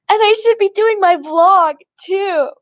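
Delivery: high, loud mock outburst; replaying her own frustrated snap, hammed up; going off on her. Venting to her friend about missing her vlog, high mock outburst. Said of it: "I'm kinda going off on her".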